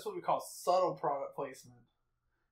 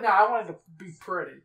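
louder, mock-insistent retort; lightly mocking imitation of a friend; mimicking friend; 7 dB louder, faster than usual. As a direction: throw the friend's demand back loudly. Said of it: "You're like".

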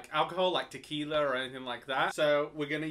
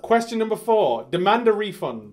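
loud, punchy shouted question; quiz show host; 5 semitones higher, 12 dB louder than usual. Acted. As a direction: announce it at full volume. Plays rapid-fire quiz host.

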